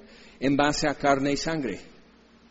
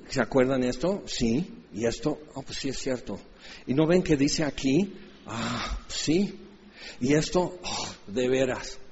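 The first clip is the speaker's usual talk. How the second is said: hushed realization; 3 semitones lower, 6 dB quieter than usual. Retold or imitated. Acted dialogue quiet.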